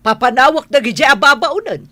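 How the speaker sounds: fast, high angry scolding; angry mother. Acted. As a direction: shout him down in outrage. Mother forbids son's marriage to maid.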